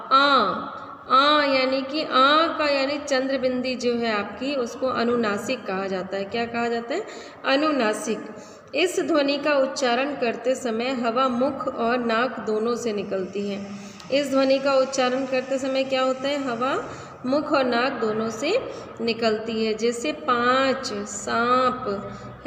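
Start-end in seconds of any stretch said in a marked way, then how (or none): 1.1-2.7 आ drawn out slowly through mouth and nose; nasal आ demo. Shown as a demonstration. Teacher showing the chandrabindu sound, drawn out. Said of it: "अब हमारा आता है आ". 19.7-22.4 पाँच and सांप stretched slowly, vowel through the nose; slow nasal demo. Shown as a demonstration. Teacher showing students the anunasik sound, slowly. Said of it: "ऐसे बोलते हैं ना हम".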